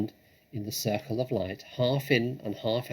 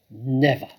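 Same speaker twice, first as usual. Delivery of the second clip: louder, defiant denial; firm never; 3 semitones higher, 8 dB louder than usual. Acted. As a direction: refuse it outright. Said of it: "said Mister Fox".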